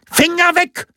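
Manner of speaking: very high, sharp snap; squeezed, high-pitched hands-off command; hissed warning. A narrator's reading. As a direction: hiss it as a sudden order. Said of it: "zischte der hagere Amtsdiener".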